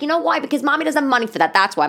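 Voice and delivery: firm mom retort. Retold or imitated. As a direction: shut down a child's pleading. Mother retorting to pleading daughter.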